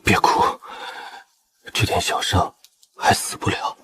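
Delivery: weak, breath-heavy, fading voice; wounded reassurance. Acted. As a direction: soothe her through the pain. Wounded general soothes crying wife. (负伤虚弱地安慰)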